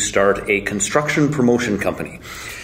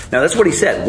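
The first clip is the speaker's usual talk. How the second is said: ordinary talk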